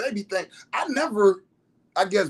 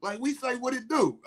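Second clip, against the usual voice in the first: higher, laid-back Southern greeting; Florida friend's voice; 8 semitones higher, 4 dB quieter than usual. Retold or imitated. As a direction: greet like a Florida homie, pitched up. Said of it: "He said".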